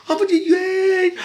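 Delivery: sarcastic imitation of a gleeful cheer; mock joyful voice. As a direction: ham up a cheerful fake voice. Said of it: "la voix joyeuse a parlé ici".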